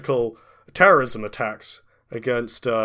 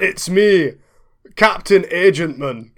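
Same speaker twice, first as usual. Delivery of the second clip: high, loud, hammed-up Scottish brogue; Scottish accent; 5 semitones higher, 6 dB louder than usual. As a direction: boom out a greeting in a thick Scottish accent. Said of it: "Breaks out the Wallace Agentman accent".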